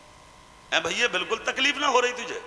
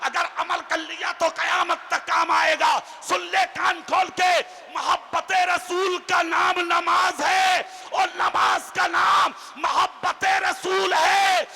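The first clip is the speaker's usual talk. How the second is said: preaching at full force; 8 semitones higher than usual. Acted. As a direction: hammer the lesson home to the crowd. Preacher exhorting the gathering. (ऊँची, धीमी, ज़ोरदार तक़रीर)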